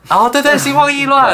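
high, loud eureka cry; excited. Real, not acted. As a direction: burst out as it clicks. Sudden realization of the idiom.

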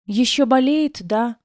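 put-on a little girl asks sadly, pitched high; small child, worried and sad; a child asks if her father is still ill; sad child's question. A narrator's reading. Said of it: "грустно спросила девочка".

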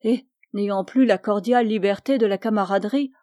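ordinary delivery, nothing marked

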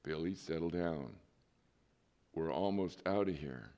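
soft, low and steady; patient father speaking low to a screaming child; low calm voice. Retold or imitated. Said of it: "in a very low voice".

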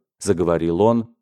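ordinary delivery

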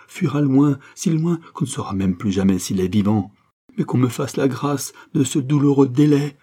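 high, loud, impassioned appeal; anguished pleading. Acted. Wife begging time until her husband dies, anguished. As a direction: plead with surging intensity. Mother begs for a delay.

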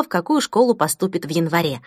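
usual speaking voice

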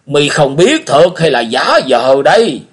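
put-on high, loud, slow probing old man's voice; suspicious old beggar. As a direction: press him with suspicion. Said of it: "Lão Cái hỏi lại".

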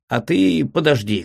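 ordinary delivery, nothing marked

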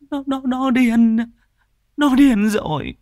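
high, loud anguished stammer; anguished wail. Acted. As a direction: cry it out in despair. Grieving mother recounting the murder. (bà mẹ gào lên đau đớn với con trai)

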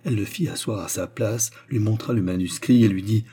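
usual speaking voice

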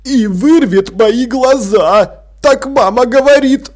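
put-on high, whining boy's wail; whimpering. A narrator's reading. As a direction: whimper the dreaded punishment. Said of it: "прохныкал Артур".

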